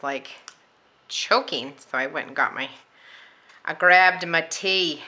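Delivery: ordinary talk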